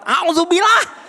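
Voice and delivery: hammed-up furious, high and slow, half laughing; comic exasperated refuge-seeking; mock angry ta'awuz. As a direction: spit the prayer out like a glaring mother. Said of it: "Ibu akan tambah melotot".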